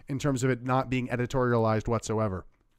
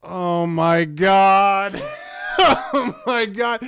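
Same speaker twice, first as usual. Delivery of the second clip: slow, drawn-out, laughing oh my God; stunned laughing exclamation; laughing disbelief; 5 semitones higher, 5 dB louder, much slower than usual. Real, not acted. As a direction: crack up in disbelief.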